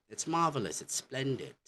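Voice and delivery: high, swooping British praise; British approval. Acted. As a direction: gush like a pleased Brit. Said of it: "in British, British, what they say".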